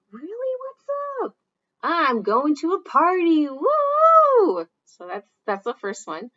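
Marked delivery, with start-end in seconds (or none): 0.1-1.4 much higher, curious and upbeat; high eager question; eager reply. Acted. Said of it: "the one that's responding". 1.8-4.7 loud, much higher whoop; loud high party whoop; excited cheer. Acted.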